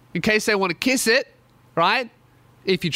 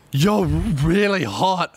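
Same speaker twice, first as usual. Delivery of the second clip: put-on drunk come-on; 2 semitones lower, much slower than usual. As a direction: drag it out like a tipsy admirer. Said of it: "she's a little bit drunk".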